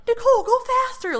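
high, fast, loud mock instructor yell. Pushy instructor yelling at Nicole: fast mock yell. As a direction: bark at her like a pushy coach. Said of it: "I don't want them screaming at me".

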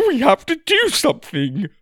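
high, loud desperate cry. A narrator's reading. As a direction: beg through the panic. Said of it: "Ma cried".

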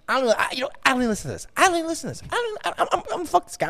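very high-pitched refusal to listen; mock exasperated grumble in a high voice; imitated fed-up contractor. Contractor muttering once he leaves.

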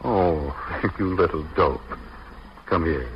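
low, soft fond murmur; soft, low affectionate teasing; tender. Acted. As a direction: comfort them gently and intimately. Husband comforts tearful wife.

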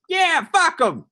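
put-on high-pitched old-movie gangster voice; thirties gangster. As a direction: bark it like a Bowery tough. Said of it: "Not in that voice".

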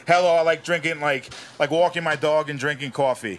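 New York accent at an easy pace; generic New York accent. Shown as a demonstration. First step of Walken tutorial, plain New York accent. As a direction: lean into broad New York vowels. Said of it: "your generic New York accent".

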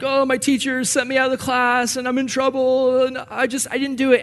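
high, whiny schoolkid complaint; whiny kid. Retold or imitated. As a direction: complain like a kid who's in trouble. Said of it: "I would complain".

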